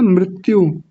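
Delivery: मृत्यु as mrit-yu; correct मृत्यु. Shown as a demonstration. Teacher giving the word itself, मृत्यु with ri. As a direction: clear short i after r in मृत्यु. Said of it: "गलत उच्चारण किया जाता है".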